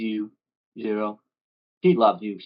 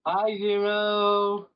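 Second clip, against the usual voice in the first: slow, much higher dopey voice; slow high goofy greeting; 10 semitones higher, much slower than usual. Acted.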